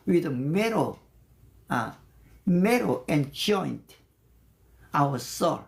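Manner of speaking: ordinary talk, nothing marked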